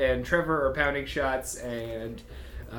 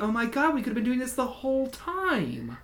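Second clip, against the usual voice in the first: higher, eager and thrilled; excited gushing; 8 semitones higher than usual. Acted. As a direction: gush like an excited new friend. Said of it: "And Reaper's like".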